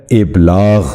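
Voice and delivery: ابلاغ with a short "i" first, ib-laagh; correct pronunciation. Shown as a demonstration. Teacher repeating for viewers the correct ابلاغ, ib-laagh. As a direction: say ابلاغ carefully as ib-laagh, not ab-laagh. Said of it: "درست تلفظ ہے".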